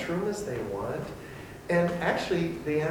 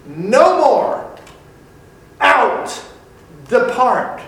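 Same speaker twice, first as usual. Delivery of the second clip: loud, higher defiant commands; defiant rebel shout; 9 semitones higher, 14 dB louder, much slower than usual. Acted. Rebels raising fists against the Almighty, shouting defiantly. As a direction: shout God out of your life. Voicing fists raised in revolt.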